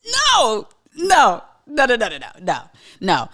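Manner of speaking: high loud no no no fading down; emphatic no. Real, not acted. Answering whether she misses stages, loud no. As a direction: shut it down hard, then trail off.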